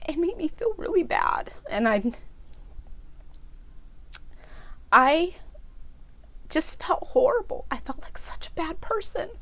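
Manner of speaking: fighting back tears as she speaks; crying. Real, not acted. Recalling the homeless man she brushed off, crying. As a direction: voice breaking high, through real tears. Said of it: "I always cry when I tell this story".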